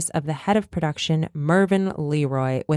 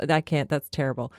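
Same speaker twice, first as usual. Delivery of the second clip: normal talking voice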